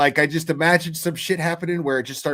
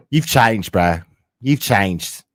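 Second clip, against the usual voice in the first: lower, in a badly faked English accent; mock London accent, teasing; terrible English accent; 7 semitones lower, much slower than usual. Mimicking Cherry teasing him, a bad English accent. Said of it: "I just do a terrible fucking English accent".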